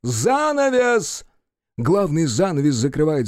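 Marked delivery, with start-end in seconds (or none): 0.0-1.2 very high, stretched-out call; theatrical shouted command. Acted. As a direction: cry it out long, high above normal. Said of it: "Мольер кричит".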